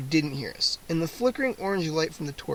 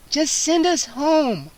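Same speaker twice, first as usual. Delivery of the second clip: put-on much higher, slow girl insisting; insistent girl; 9 semitones higher, 3 dB louder, slower than usual. A narrator's reading. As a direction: plead and demand at once. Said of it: "Kara insisted".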